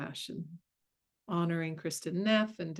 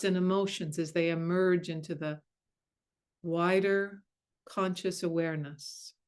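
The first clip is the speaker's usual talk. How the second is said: guided meditation. Acted. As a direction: ease them gently into stillness.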